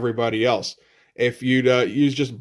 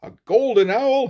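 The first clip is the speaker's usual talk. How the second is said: high-pitched theatrical question; mock diatribe; 9 semitones higher than usual. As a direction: push the haggling question up high. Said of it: "starts to go into a diatribe".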